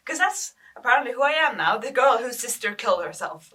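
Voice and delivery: loud, bitter echo of the label; bitter outburst. Acted. Grieving sister repeating what schoolmates call her, loudly bitter.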